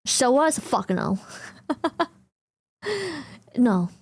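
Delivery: normal talking voice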